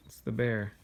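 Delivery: ordinary delivery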